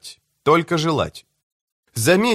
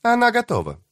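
very high, held pitch; high-pitched character on the line; 11 semitones higher than usual. A narrator's reading. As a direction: lift it far above your usual voice. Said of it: "отозвался высокий голос".

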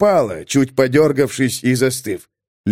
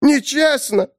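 sharp high cry; high petulant scream; high shriek; 14 semitones higher, slower than usual. A narrator's reading. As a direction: shriek the word. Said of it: "закричала Апустелла".

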